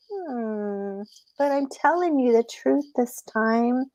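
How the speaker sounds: lightly voiced trickster rabbit; innocent rabbit. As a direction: insist gently, feigning honesty. Rabbit insists it is honest.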